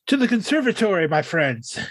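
grand Victorian gentleman voice. Retold or imitated. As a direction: declaim it like a Victorian gent. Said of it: "in the most Victorian voice he can do".

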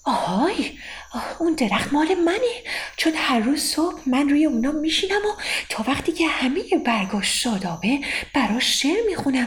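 high, proud little bird claiming its tree; a songbird insisting the tree is hers; proud nightingale. Acted.

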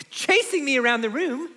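high, breathless-with-scandal mom impression; mom telling her tale. Mom recounting being chased round the room, high animated mom voice. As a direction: imitate a mother, pitch climbing as she tells it. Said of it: "and she said".

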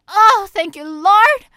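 loud, high cry of relief to the heavens; relieved cry. Retold or imitated. Actress spared from screaming on set, loud relief. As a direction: throw it up to the sky in relief. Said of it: "Og jeg bare".

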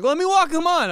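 high, loud wheedling push to help; pushy eager offer. Retold or imitated. Retelling his offer to a reluctant friend, loud eager insisting. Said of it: "And I'm like".

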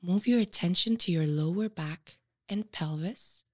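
usual speaking voice

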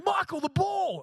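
sharp high call across the pitch; retold shout. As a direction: yell his name to warn him. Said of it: "someone shouted at me".

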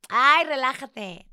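mocking a carefree "relax"; mock dismissive. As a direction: parrot the friends' easy brush-off, slowly. Friends brushing off her distress.

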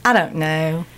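Southern "I don't know"; Southern accent. Shown as a demonstration. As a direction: drop into Southern vowels. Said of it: "switch your I's".

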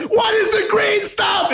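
very high, frantic mock screaming; really loud yelling. Hero questioning the innkeeper, hammed-up frantic screaming. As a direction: shriek each question like a hopelessly clueless hero. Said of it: "wow, that was really loud".

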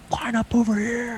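high, slow shouted order; retold yell. Quarterback friend yelling at his running back, high slow shout. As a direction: bark it like a fired-up quarterback. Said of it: "yelling at me".

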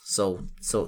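usual speaking voice

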